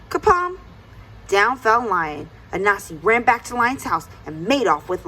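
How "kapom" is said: sudden high, loud bang word; storyteller's bang word; kapom sound effect. A narrator's reading. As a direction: pop it out like a thump. Sudden fall in folktale.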